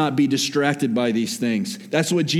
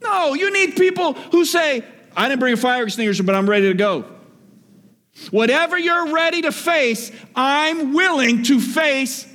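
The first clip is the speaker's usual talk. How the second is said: preaching at full force; 7 semitones higher than usual. Acted. Preacher urging the church to face fire together, impassioned. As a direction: push it to the back row.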